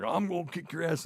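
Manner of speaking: hammed-up tough-guy threat; mock angry threat. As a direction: mimic the angry driver's threat. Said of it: "And then he's like".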